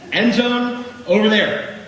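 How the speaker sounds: hammed-up simple-minded coach talk; mock caveman explanation. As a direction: spell it out like a caveman. Explaining football in caveman terms.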